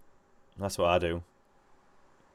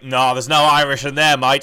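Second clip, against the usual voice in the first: loud, higher mock protest; retold indignant denial; 4 semitones higher, 10 dB louder than usual. Friend retelling Russell Crowe's radio row, mock indignant denial. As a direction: imitate an offended man flatly refusing it. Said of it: "he was like".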